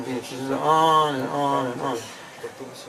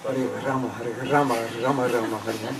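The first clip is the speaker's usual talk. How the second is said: the mantra with rolled Mexican r in "Rama", lower; Mexican accent; 4 semitones lower, 4 dB quieter than usual. Retold or imitated. Imitating Mexico's devotees, Mexican-accented chanting. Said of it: "if you're from Mexico".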